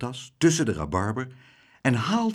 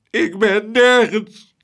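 higher, louder, slow sobbing wail; 12 semitones higher, 10 dB louder, slower than usual. Acted. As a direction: let the repeat break into a hammed-up sob. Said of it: "snikte meneer Tump".

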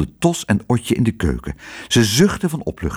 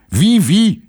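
hoog en luid, uitbundig; vrolijke hotelbaas; 11 semitones higher, 5 dB louder than usual. A narrator's reading. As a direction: speel de opgewonden hotelbaas. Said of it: "zei meneer Pardoes". (Pardoes praat opgewonden tegen de Fransen)

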